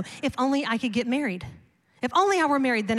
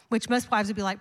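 usual speaking voice

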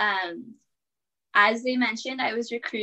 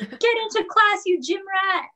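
imitated teacher yell; 11 semitones higher than usual. Disciplinary teacher catching a student running in late, mock yelling. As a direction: imitate a teacher calling out, pitched way up. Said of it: "disciplinary teacher ... was like, "Yell".

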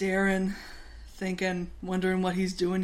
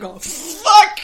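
loud, higher exclamation of dismay; acted-out panicked yelp; mock shouted curse; 10 semitones higher, 11 dB louder than usual. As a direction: blurt it out loud as a comic retelling. Acts out dropping the coffee.

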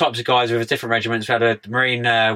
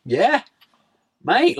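eager yes; 11 semitones higher than usual. Retold or imitated. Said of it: "agreeing eagerly".

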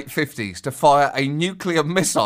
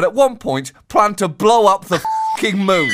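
indignant punch; 5 semitones higher, 4 dB louder than usual. Acted. Outraged punchline.

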